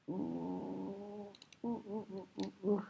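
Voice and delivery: soft tense oohs, breath held; barely breathing through delicate work; holding breath. Real, not acted. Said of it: "When I hold my breath".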